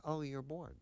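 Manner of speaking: high, airy, unbothered parent; breezy parent voice. Retold or imitated. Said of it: "It's like".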